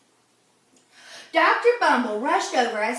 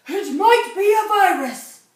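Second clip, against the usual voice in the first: a louder, higher doctor voice, sure of itself; announcing with authority; 5 semitones higher, 9 dB louder than usual. Acted. Doctor announces the diagnosis to the family, with authority. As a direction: proclaim it like the expert in the room. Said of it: "he announced with authority".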